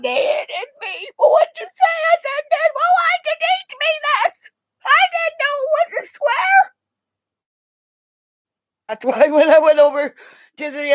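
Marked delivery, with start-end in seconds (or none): 0.0-2.0 put-on high, tearful child's wail; crying child. Child coming home hit, crying to parent. Kid and parent quarrel retold. 2.5-6.7 put-on high, whiny kid voice; whining child. Child blaming parent for teaching swear words, whining.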